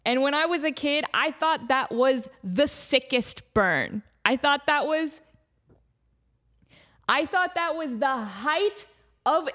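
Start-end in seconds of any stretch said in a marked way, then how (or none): none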